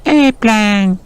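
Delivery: put-on high toddler exclamation; tiny storybook boy's drawn-out cry; George. A narrator's reading. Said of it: "George shouted".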